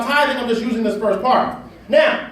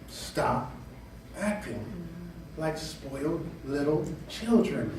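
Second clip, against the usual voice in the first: low, much softer, slow voice of God; God's stern rebuke; 9 semitones lower, 14 dB quieter, slower than usual. Acted. God scolding his children in a low hushed voice. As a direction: hush it down low like a grave father. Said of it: "God says".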